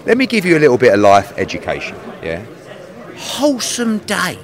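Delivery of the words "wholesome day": drawn-out, higher-pitched mocking repeat; incredulous slow quote; mocking echo. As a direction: echo their phrase back, slow and teasing. Mocking guest's phrase back at him.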